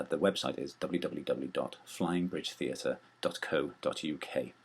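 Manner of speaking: ordinary talk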